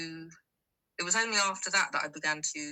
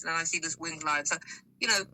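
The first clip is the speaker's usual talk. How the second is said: ordinary talk, nothing marked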